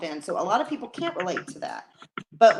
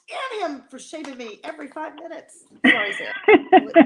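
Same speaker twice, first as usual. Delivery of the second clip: laughing through an apology, going higher; 8 semitones higher, 9 dB louder than usual. Real, not acted. Apologizing to co-host Sarah for talking long, laughing. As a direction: giggle out the apology.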